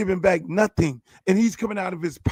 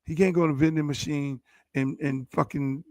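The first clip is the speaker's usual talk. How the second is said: ordinary talk, nothing marked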